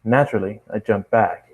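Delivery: usual speaking voice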